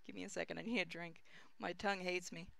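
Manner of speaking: usual speaking voice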